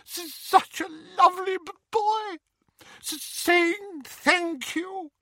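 high, stammering tearful wail; sobbing. A narrator's reading. Aunt Petunia praising her son through tears, sobbing high. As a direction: choke the words out through high-pitched sobs. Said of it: "she sobbed into his massive chest".

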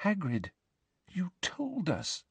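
frightened hush. A narrator's reading. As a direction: plead softly, scared of what's sleeping nearby. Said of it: "her wand now shaking in her hand".

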